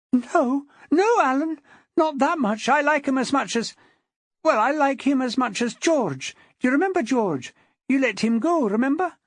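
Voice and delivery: very high, urgent woman's voice; pleading wife. A narrator's reading. Wife begging her husband to spare the man, urgent pleading. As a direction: plead anxiously, pitched high throughout.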